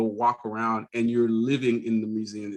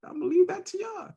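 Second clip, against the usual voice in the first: mock dismissive; 11 semitones higher than usual. Real, not acted. Teasing Boston mock dismissal.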